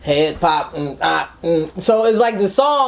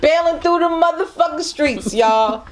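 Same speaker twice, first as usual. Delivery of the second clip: hyped; 7 semitones higher, slower than usual. Real, not acted. Excited hype shout.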